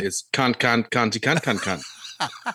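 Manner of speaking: put-on joking Australian accent, laughing at the end. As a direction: ham up a crude Australian accent. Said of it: "if you want my Australian accent".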